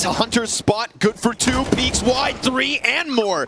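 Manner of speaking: high hyped play-by-play; esports caster. Real, not acted. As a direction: call the multi-kill. Live play-by-play.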